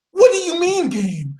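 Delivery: high-pitched, incredulous question; comic indignant squeak; mock disbelief. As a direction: start high and drop, as if baffled. Sagittarius reacts in skit.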